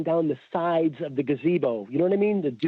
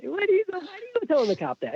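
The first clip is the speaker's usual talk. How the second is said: exasperated wife; faster than usual. Retold or imitated. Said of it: "my wife's like".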